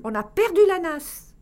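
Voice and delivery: high, loud dismayed shout; alarmed cry. A narrator's reading. Wife telling Loul they lost the trap, dismayed shout. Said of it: "cria la femme de Loul".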